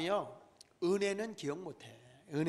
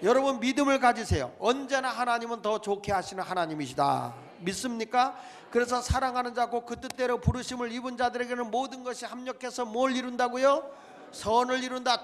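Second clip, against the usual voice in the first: loud, ringing sermon delivery; preaching; 4 semitones higher, 6 dB louder than usual. Acted. Pastor exhorting his congregation, preaching at full force. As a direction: preach it out to the whole church.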